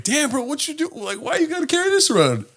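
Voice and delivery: imitated baffled question, high and soft; mock-puzzled high voice; high-pitched disbelief. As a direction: ask it high, quietly incredulous. Retold question to a blade carrier.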